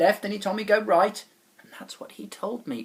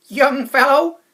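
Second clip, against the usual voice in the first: booming, higher voice of the wobble chap; loud old chap; 10 semitones higher, 14 dB louder, slower than usual. Acted. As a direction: call out heartily like a jolly stranger. Wobble chap calls out to boy.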